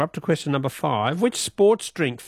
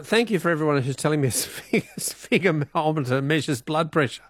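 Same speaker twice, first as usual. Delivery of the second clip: ordinary talk, nothing marked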